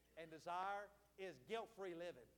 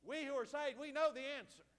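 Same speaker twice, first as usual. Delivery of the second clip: louder, insistent preacher's call; emphatic repeat; 4 semitones higher, 6 dB louder than usual. Real, not acted. Preacher prompting the congregation for an amen, loud and emphatic. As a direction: drive it home to rouse a response.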